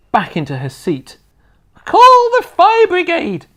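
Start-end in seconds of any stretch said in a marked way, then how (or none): 1.8-3.5 high, loud panicked yell; sudden shout. A narrator's reading. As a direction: scream for the fire brigade. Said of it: "she shouted suddenly".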